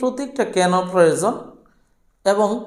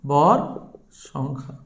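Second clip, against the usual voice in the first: ভর, a pause, then a much softer, lower সংখ্যা; slow dictation; 5 semitones lower, 3 dB quieter, slower than usual. Real, not acted. Teacher repeating the term's name for students, slow dictation. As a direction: separate ভর from সংখ্যা, letting the second word drop. Dictates term while writing it.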